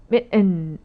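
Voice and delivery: 밑 then 은, split apart with a pause; syllable split. Shown as a demonstration. Teacher splitting the word into syllables for learners. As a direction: break it into 밑 and 은. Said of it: "은 이런 소리가 나는 거죠".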